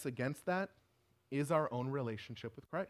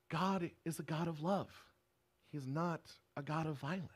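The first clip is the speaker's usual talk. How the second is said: ordinary delivery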